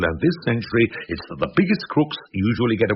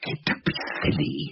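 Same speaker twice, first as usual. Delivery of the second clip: hushed plea; 7 semitones lower, 6 dB quieter than usual. Acted. Soothing a distraught husband.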